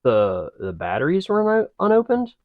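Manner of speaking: shooting up high on "unopened"; astonished echo, climbing to a squeak; incredulous. Real, not acted. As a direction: question it in rising disbelief. Incredulous echo of eerie detail.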